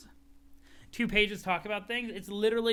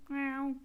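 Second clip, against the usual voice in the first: cat meow; 8 semitones higher, 3 dB quieter than usual. Acted. Cat meows in scene.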